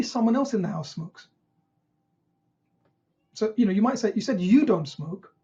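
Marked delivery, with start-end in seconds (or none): 4.1-5.3 slow, leaning on the second "you"; "you" stretched and stressed, as a careful query. Shown as a demonstration. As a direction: echo it back with the weight on "you" before "don't". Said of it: "the emphasis on the I".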